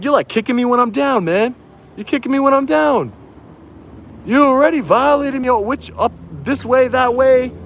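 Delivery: high-pitched, indignant retelling of his protest; indignant complaint. Retold or imitated. Telling the sergeant he's kicking him while he's down, high and indignant. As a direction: whine it up high like you're being wronged. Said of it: "I'm like".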